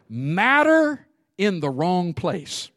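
each word spaced and weighed; slow, deliberate definition. Shown as a demonstration. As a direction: lay out the definition slowly, word by word. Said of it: "here's a definition of dirt".

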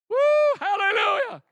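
high, loud exultant cry; joyful shout. Real, not acted. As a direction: shout it to the rafters in praise. Celebratory cry at climax.

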